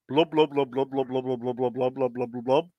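burble on one note as if underwater; underwater blubbing. Acted. Soldier in a tank to his mate, underwater blubbing. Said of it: "One looks at the other and says".

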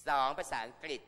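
second point, called out loud; emphatic listing. Real, not acted. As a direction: hammer the point home to the class. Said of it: "listing points to the class".

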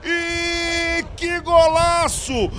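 roaring GOOOL, stretched and ecstatic; explosive goal commentary. Real, not acted. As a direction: scream the goal call with full lungs. Gol narrado ao vivo. (grito de gol explosivo)

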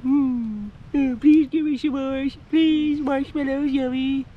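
high, loud, drawn-out pleading; hammed-up childish begging for the goat; voiced begging kid. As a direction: beg for treats in a cute squeaky pitch. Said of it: "it's like".

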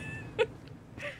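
high, airy giggles tailing off; nervous giggle. Real, not acted. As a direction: giggle out the panic. Laughter trailing after panic.

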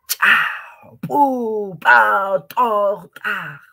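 Cha, pu, pa, to, ta popped hard and loud; explosive ejectives. Shown as a demonstration. Teacher showing ejective sounds to the class, popping. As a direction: burst each syllable like something exploding. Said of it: "como si estarían haciendo reventar o explotar algo".

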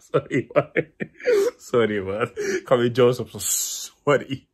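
loud, breaking up with laughter; laughing apology. Real, not acted. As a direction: apologize through real giggles. Apologizes while cracking up. (lachend sorry zeggen)

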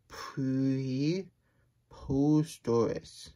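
very slow preposterous; drawn out word. Acted. Reading a big word from the book, very slowly. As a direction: stretch preposterous syllable by syllable.

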